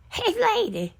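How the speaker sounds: high calling out, falling at the end; imitating a holler. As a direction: holler it again, high and insistent. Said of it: "started hollering".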